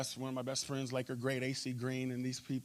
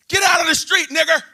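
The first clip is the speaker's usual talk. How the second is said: furious yell, much higher and louder; angry shout; 14 semitones higher, 19 dB louder than usual. Retold or imitated. A driver yelling at him from his truck in hateful rage. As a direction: scream it from a truck window. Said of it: "and he yells".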